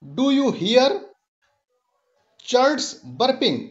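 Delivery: slow recitation of "It tickles my bones"; measured, unhurried poem reading; poem recital. Shown as a demonstration. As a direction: savour each line of the poem for the class. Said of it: "दूसरा स्ट्रंजा है कि".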